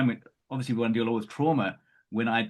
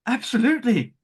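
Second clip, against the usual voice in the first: high, louder, eager confirming voice; excited client; 8 semitones higher, 4 dB louder, faster than usual. Retold or imitated. Client confirming the red roses to the medium, excited. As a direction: burst out with it, thrilled. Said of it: "And he went".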